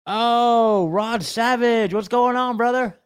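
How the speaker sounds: excited greeting. Real, not acted. Host greets long-awaited caller.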